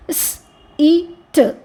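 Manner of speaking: "స్, ఈ, ట్" as three separate sounds; sounded out. Shown as a demonstration. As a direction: break "స్, ఈ, ట్" into weak consonant, vowel, weak consonant. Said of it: "వీక్ సౌండ్స్కి ఒక vowel sound".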